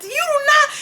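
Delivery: very high imitation of mom screaming; hammed-up scolding squeal; mock screaming. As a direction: act out a mother forbidding it. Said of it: "she was screaming".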